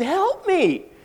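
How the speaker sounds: high, loud acted yell; storybook character's shrill-pitched call for help; cry for help. Stuck spider calls for help.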